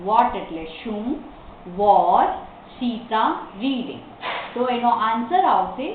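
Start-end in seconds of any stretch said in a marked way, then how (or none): 1.8-4.0 slow, separated words. Shown as a demonstration. Teacher takes learners through the question, word by word. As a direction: dictate it piece by piece.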